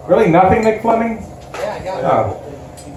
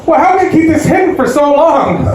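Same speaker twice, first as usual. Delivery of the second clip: high mock-concerned mom; a hammed-up worried mother; mom asking; 9 semitones higher than usual. Mom quizzing her son, mock concerned. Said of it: "My mom".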